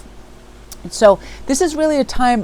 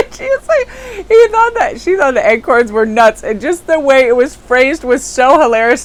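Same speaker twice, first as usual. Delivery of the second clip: high, louder, through laughter; laughing while talking; 6 semitones higher, 7 dB louder than usual. Real, not acted. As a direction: get the words out while still cracking up. Telling story while cracking up.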